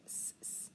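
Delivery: s as a soft voiceless sibilant; isolated s sound. Shown as a demonstration. Teacher sounding out sadly, separate soft s sounds. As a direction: say "s" twice as a bare sound, softly. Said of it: "let's try to spell sadly".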